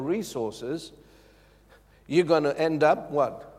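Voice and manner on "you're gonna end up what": ordinary talk, nothing marked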